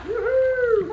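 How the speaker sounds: very high woohoo; cheering whoop. Real, not acted. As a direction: whoop in delight. Cheering the cannonball.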